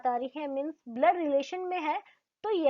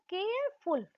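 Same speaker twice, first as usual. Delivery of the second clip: Careful said in two parts, higher pitched; split into parts; 5 semitones higher, 5 dB quieter than usual. Shown as a demonstration. Teacher demonstrates syllables to the class, higher and split. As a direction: break careful into two beats, a little higher. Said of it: "एक साथ नहीं बोला".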